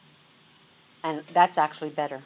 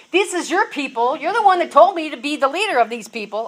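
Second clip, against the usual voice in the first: higher, louder, hurried protest to God; imagined complaint; 7 semitones higher, 8 dB louder than usual. Retold or imitated. Moses complaining to God about his people, exasperated. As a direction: throw the responsibility back at God. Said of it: "I can almost hear him say".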